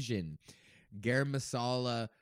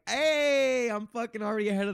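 excited hey; 10 semitones higher, 7 dB louder than usual. Real, not acted. Boasting about owning the gear.

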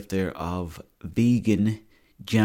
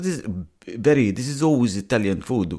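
mock Italian accent; 2 semitones higher, 3 dB louder than usual. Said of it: "He goes".

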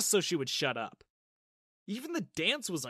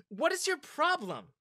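yelling; 12 semitones higher, 3 dB louder than usual. Acted. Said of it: "started yelling".

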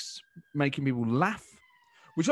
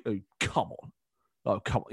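low, mock-incredulous protest; lowered voice, scoffing incredulity; Boris; 5 semitones lower than usual. Impressionist voicing a reply to any question, mock incredulity. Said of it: "that sort of mock incredulity".

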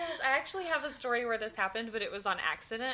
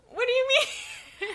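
much higher, slow, stretched disbelief; mock surprise; 6 dB louder, slower than usual. Narrator reacting to the missing lunch, mock surprised. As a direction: play dumb in high wide-eyed shock. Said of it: "And I go".

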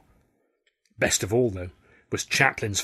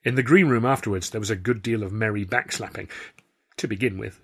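usual speaking voice

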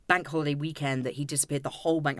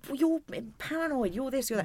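mock husband; 8 semitones higher than usual. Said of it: "he'd do that".

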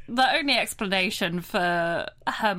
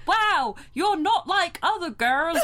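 put-on American faking a British accent; bad posh British impression; fake British accent; 8 semitones higher than usual. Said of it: "an American doing a fake British accent".

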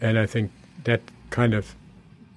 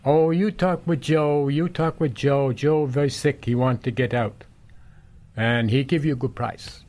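put-on Italian accent; 5 semitones higher than usual. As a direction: press him kindly in a slow Italian accent. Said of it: "in heavy Italian accent".

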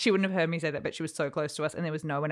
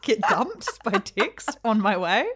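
slow, higher laughing mock movie line; 7 semitones higher, 6 dB louder, slower than usual. Joking about chasing the star, laughing through it. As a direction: play it like a film chase, giggling.

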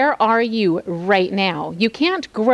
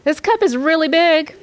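higher, louder anxious musing; Jesus praying, pitched higher; voicing Jesus; 8 semitones higher, 4 dB louder than usual. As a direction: weigh the heavy cup aloud. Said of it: "Jesus thought to pray".

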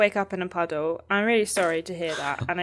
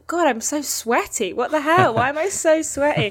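high, louder burst of baffled disgust; mock-baffled complaint, pitched up; retold exclamation; 7 semitones higher, 4 dB louder than usual. Retelling waking up sweaty to co-hosts, exasperated exclamation.

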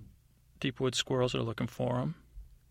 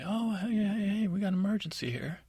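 high, urgent squirrel cries; excited squirrel calling; 8 semitones higher than usual. Acted. Squirrels hail passing elves for help, high and urgent. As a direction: flag them down, it's an emergency. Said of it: "elves walking and say".

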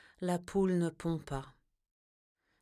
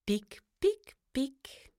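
high, fading to a whisper; pecking sounds; 9 semitones higher, much slower than usual. Acted. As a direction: peck peck, tiny and high. (pic pic aigu qui finit chuchoté)

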